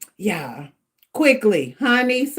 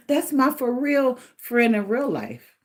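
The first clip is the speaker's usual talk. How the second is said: normal talking voice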